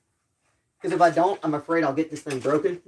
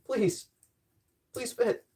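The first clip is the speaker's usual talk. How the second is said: soft, higher-pitched begging; pleading; 5 semitones higher, 6 dB quieter, much slower than usual. Real, not acted. Tinkerer begging the tool to fit, soft pleading. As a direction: beg it quietly.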